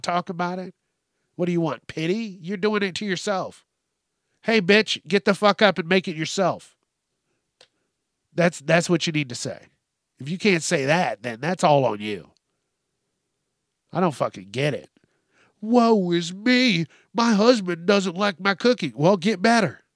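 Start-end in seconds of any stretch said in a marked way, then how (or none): 4.4-6.8 louder, higher barked command; mock shout at wife. Advising what husband should say. 15.6-18.9 mocking whiny wife. Mocking the TikTok woman.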